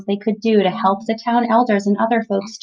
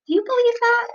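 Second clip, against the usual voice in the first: incredulous, pitched way up; surprised question; faster than usual. Real, not acted. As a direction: ask it in mock disbelief, high. Mother asks child, surprised.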